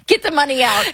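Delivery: high, laughing delivery; amused, pitch jumping up through laughter. Real, not acted. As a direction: laugh your way through the words. Joking about inheritance problem, laughing.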